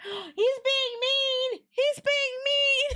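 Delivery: high, slow whimpering complaint; whiny little voice. Acted. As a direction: sulk like a wounded lady.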